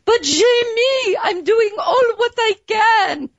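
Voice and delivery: put-on high, slow, pleading French accent; adorable French accent. Wife mimicking her husband's protest, adorable French accent. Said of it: "in this adorable French accent".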